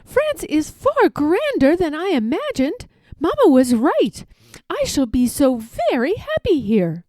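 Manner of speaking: put-on a girl telling her dog about France; delighted young girl. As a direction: gush about the palace like a thrilled girl. Girl delighted with new palace.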